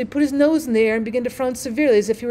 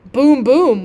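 booming firework sound; 3 semitones higher, 9 dB louder than usual. A narrator's reading.

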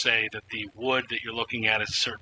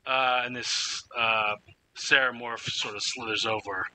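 ordinary delivery

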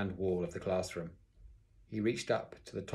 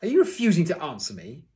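loud, high, outraged question; shouted; 8 semitones higher, 10 dB louder than usual. A narrator's reading. Teacher confronting silent pupil, shouted. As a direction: thunder the demand. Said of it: "he shouted".

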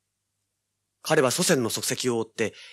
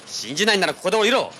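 much higher and louder, a harsh order; angry shout; 9 semitones higher, 5 dB louder than usual. Acted. Detective ordering his doubting partner out of the car, shouting. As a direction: bark it as an ultimatum.

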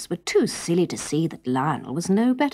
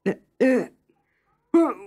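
high, hesitant flustered excuse; flustered stalling; 8 semitones higher, 4 dB louder, slower than usual. A narrator's reading. Lionel making excuses to his subjects. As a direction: stall nervously.

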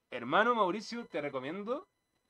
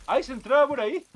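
startled exclaim; 10 semitones higher, 9 dB louder than usual. Real, not acted. Enemy slipping in.

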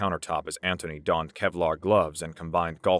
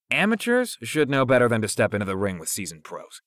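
pitched-up scolding, high on the first word; chastising; 4 semitones higher, 4 dB louder than usual. A narrator's reading. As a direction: chastise him with a raised voice. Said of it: "Jordan chastised".

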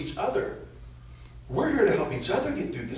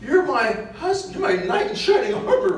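high, laughing mock-hurt wife; imagined wife's plea; 11 semitones higher, slower than usual. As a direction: ham up a wife who feels forgotten. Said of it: "She's thinking".

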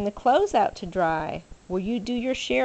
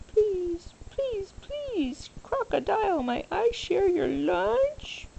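high pleading begging voice, much higher; 10 semitones higher, slower than usual. Acted. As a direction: beg sweetly in a high squeak, slow. Hungry alligator begs.

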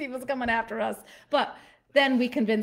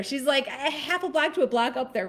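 usual speaking voice

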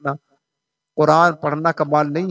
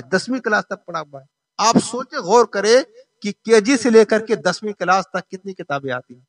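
ordinary talk, nothing marked

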